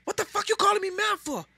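high, mock offended voice; New York stepmom retort. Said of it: "Yes, ma'am".

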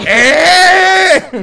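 sharp high yell; shout. Real, not acted. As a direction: yell out at the enemy. Reacting to ambush.

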